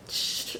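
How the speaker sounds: quiet hissed "shh" for a pause; soft shush. Retold or imitated. Showing the tiny little pause in the song, soft shush. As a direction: imitate a tiny hush in the music. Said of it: "You just like".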